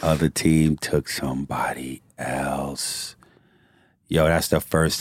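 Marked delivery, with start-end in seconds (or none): 0.0-3.1 drawn-out, quieter repeat; slow, heavy repeat. Real, not acted. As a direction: let each word sink in slowly. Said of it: "repeated slowly".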